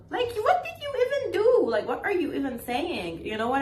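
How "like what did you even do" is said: indignant. Real, not acted. Indignant retort to ungrateful accusation.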